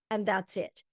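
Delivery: ordinary talk, nothing marked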